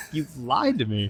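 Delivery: held-back pretend yell, kept soft; mock yell. Confronting Chief Peaches on request, half-hearted mock yell. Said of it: "Yell it".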